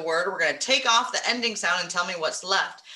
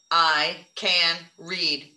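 slow, one word at a time; slow counting; 5 semitones lower, much slower than usual. Shown as a demonstration. Teacher counting the words for the children, slow. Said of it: "Count 'em".

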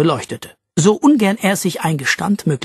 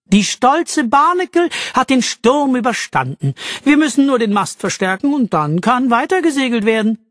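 higher-pitched, proud seafarer voice; boastful sailor Jack; 8 semitones higher than usual. A narrator's reading. Jack boasts of his ship to the castaways, proudly. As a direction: talk up your ship with swagger.

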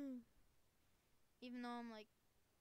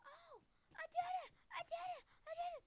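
very high, louder squealed triumph; excited squeal; 5 dB louder, faster than usual. Real, not acted. Player finally succeeding in game, real excited squeal. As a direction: squeal it over and over in delight.

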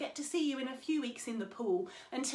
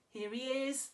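normal talking voice

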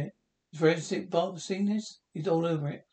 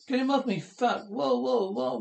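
panicked yelling; 7 semitones higher than usual. Acted. Under attack by the kid, panicked high yells. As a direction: shriek for help, terrified.

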